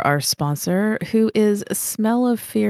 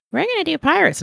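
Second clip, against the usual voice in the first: high excited announcement; Marcus impression; 11 semitones higher, faster than usual. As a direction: squeak it out like an eager host. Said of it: "And fucking Marcus was like".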